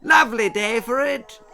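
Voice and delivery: high, loud plummy hello; posh greeting. A narrator's reading. Lady Davinia calling out as she gallops past. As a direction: call out gaily while galloping. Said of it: "waved Lady Davinia".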